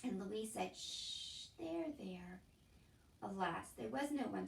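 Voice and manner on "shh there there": soft shush and murmur; soothing. A narrator's reading. Louise comforting the weeping Cook, soft and gentle. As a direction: hush and comfort her gently.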